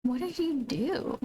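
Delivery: pet talk. Real, not acted. Streamer talking to her cat.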